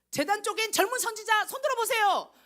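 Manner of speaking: high, loud call across a crowd. Pretend. Calling out in heaven.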